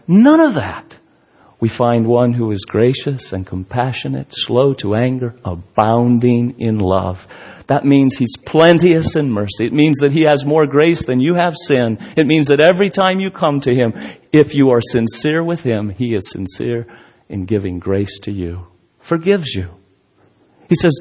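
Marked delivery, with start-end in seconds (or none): 0.1-1.0 emphatic denial. Acted. 15.9-19.7 tender and soft. Acted. Tender drop in preaching.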